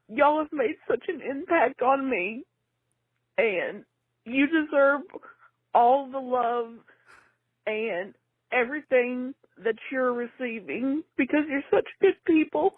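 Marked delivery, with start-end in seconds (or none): none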